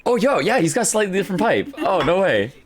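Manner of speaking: usual speaking voice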